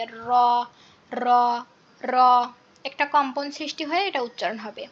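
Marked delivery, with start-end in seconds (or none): 0.0-2.2 র with a rolled, vibrating tongue; trilled r. Shown as a demonstration. Teacher demonstrating a trilled র to students. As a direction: roll the র like children's play sounds. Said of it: "এভাবে একটা কম্পন সৃষ্টি হবে".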